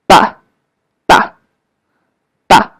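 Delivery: very short, light "Ba"; neutral tone. Shown as a demonstration. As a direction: cut "Ba" off short as if interrupted. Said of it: "Let's practice a little bit".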